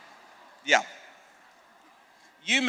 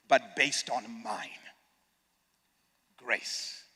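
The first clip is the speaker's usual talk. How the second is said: hushed Jesus; 11 semitones lower, 14 dB quieter, slower than usual. Acted.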